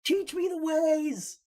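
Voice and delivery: high, slow, loud begging; mock plea. Friend quoting a meme, high slow loud plea. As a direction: drag it out like a pleading disciple.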